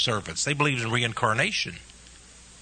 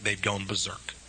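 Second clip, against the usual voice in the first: softer, dropping away; hushed before the outburst; quiet; 6 semitones lower, 9 dB quieter than usual. Real, not acted. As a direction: say it under your breath. Sermon, quiet then loud repeat.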